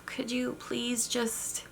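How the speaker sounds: soft, slow, hesitant plea; passive pleading. Retold or imitated. Parent meekly asking restless child to sit still, soft and hesitant. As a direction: ask timidly, barely above a murmur. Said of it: "say, something like".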